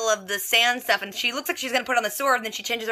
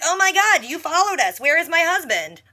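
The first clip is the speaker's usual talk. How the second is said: shocked; 7 semitones higher than usual. Retold or imitated. Snow catches Arthur following them.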